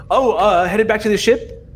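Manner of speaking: a hint of a fawning dignitary; High Regent. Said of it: "you see the High Regent go".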